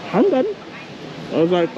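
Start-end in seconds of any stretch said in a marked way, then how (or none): 0.1-0.4 high-pitched imitated German accent; quick German-accented impression, high. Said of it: "He said it that way with a German accent".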